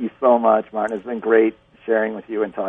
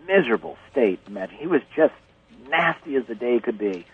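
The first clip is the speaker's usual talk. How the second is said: normal talking voice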